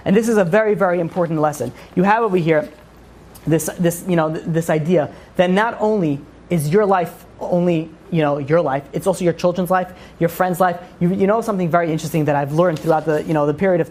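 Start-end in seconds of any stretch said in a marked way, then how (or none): none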